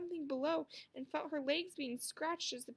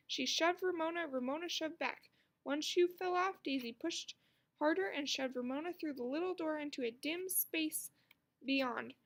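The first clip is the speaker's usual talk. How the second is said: plain reading voice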